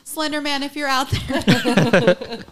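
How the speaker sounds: high, loud, drawn-out call breaking into laughter; hammed-up plea collapsing in giggles; laughing mock summons. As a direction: call into the void as a joke. Jokingly calling out to Slender Man.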